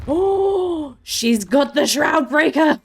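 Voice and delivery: loud, high cheering huzzahs; triumphant cheer. Real, not acted. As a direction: cheer like a victorious pirate.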